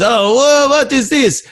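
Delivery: high-pitched questioning tourist; puzzled French guy. Acted. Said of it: "the French guy goes".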